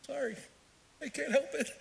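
high-pitched, squeaky stifled giggle; can't stop laughing. Acted. Pupil apologising to the teacher, helpless suppressed laughter. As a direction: act out fighting back the giggles. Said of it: "Stop laughing".

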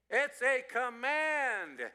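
high, loud, drawn out; booming, stretched-out declaration; emphatic announcement. Real, not acted. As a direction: proclaim it slowly and forcefully. Calls out the prized answer.

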